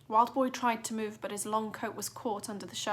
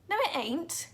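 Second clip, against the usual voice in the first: snapping; 8 semitones higher than usual. A narrator's reading. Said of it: "Clarissa snapped".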